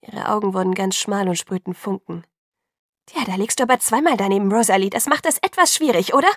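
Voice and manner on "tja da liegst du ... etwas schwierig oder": loud, quick sarcastic jab; biting. A narrator's reading. Alice telling Rosalie she is wrong, biting. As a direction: bite at her with mock politeness. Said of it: "fragte sie bissig".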